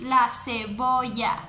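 ordinary talk, nothing marked

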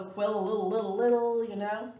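"quill, little, little" with the L repeated over and over; repeated final L. Shown as a demonstration. Teacher mocking an over-said spelling, "quill, little, little" with repeated L. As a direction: drag out the L at the end of "quill, little, little". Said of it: "you don't go".

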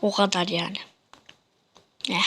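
normal talking voice

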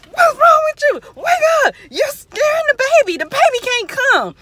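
very high, loud frantic shouting; scared friend yelling. Retold or imitated. Friend wakes snoring brother in delivery room, frantic. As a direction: shriek at the snorer in hammed-up alarm. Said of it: "like, scared".

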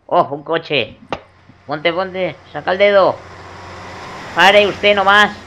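from excited calls to a full yell; calling out. Acted. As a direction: shout to flag it down. Hitchhiking, calling out to car.

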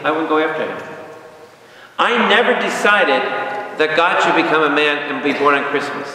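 ordinary delivery

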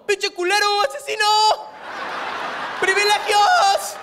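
high, outraged crowd yell, stretching the last word; heckler's shout. Acted.

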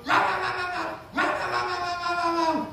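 high-pitched "rah rah" babble; squeaky, hammed-up ranting noise; mock frantic yammering. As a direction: imitate someone fussing in a high voice. Imitates the one who frets.

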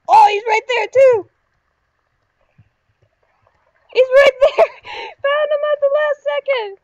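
high, loud disbelief; loud gamer outburst with a laugh; excited shouting. Real, not acted. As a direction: shout in amazement at the last-second find. Shouting as last player is found.

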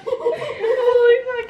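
high, slow, laughing shock. Real, not acted. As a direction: gasp it out through laughter.

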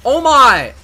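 very loud, high exclamation; startled shout. Real, not acted. Reacting to the game, startled high shout. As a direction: cry out in sudden shock.

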